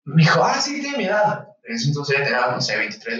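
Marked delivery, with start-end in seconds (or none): 0.4-1.5 lightly voiced young woman, higher; a casual, chirpy colleague; young doctor's voice. A young colleague chatting to him about the patient, lightly voiced high.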